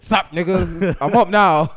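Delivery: loud, high mock outburst of someone jolted awake; mock startled shout. Teasing co-host as just woken up, mock shouting.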